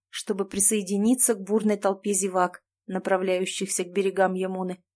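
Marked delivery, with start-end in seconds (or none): none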